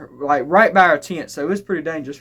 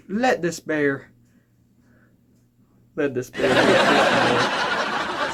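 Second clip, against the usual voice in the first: mock prayer; 4 semitones lower, slower than usual. Praying at the bear in dream.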